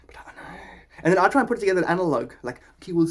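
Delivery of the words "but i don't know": whisper. Real, not acted. Trailing aside.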